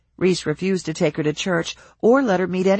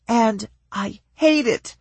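higher, slow bitter outburst; wife erupting; 6 semitones higher, slower than usual. A narrator's reading. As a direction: spit it out hurt.

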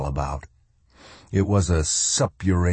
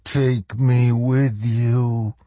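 slow, higher pleading plea; begging; 5 semitones higher, much slower than usual. A narrator's reading. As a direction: beg slowly, lifting the voice.

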